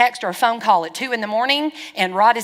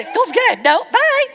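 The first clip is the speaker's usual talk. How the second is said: high squeaky cheerful brush-off; quoting her, mock high; 13 semitones higher, 5 dB louder than usual. Pastor quoting the girl saying no, high squeaky and cheerful. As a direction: toss it off like a pleased kid. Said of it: "she said".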